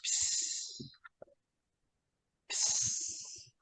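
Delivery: soft unvoiced psst psst; imitated peeing hiss. Telling friends about the camp night, a soft imitated hiss. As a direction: hiss it out like a trickle. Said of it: "All you hear is".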